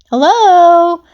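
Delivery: high, loud hello called across space; calling out. Acted. Pigtail calls out the station door to her friends, high and loud. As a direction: call out to find them. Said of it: "Pigtail's voice suddenly called out".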